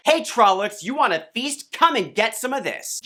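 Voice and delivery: high, cocky taunt; taunting challenge. Retold or imitated. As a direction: goad the monsters into coming. Daze taunting the monsters.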